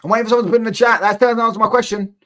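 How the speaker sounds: loud taunting challenge to the chat; taunting call-out. Real, not acted. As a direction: raise it up and call out the silent chat. Taunting rival fans, calls for answers.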